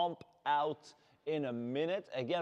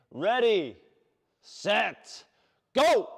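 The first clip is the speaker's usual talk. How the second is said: start call; 4 semitones higher, 8 dB louder, slower than usual. Real, not acted. Coach starts the interval.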